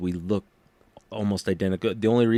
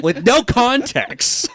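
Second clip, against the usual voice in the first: ordinary talk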